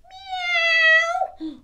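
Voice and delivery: very high, loud meowing cat. Acted. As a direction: squeal it like a startled cat. Cat in the story.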